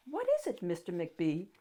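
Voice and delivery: usual speaking voice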